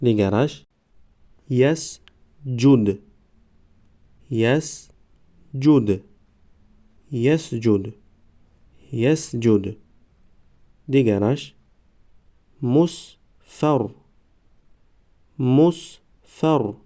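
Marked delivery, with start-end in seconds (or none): 1.5-6.0 slow, syllables held and separated; slow syllable reading. Shown as a demonstration. Teacher models first readings for learners, slow syllable by syllable. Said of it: "بار یکم و دویم آهسته". 12.6-16.7 slow, syllables held and separated; slow syllable reading. Shown as a demonstration. Teacher models first readings for learners, slow syllable by syllable. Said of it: "بار یکم و دویم آهسته".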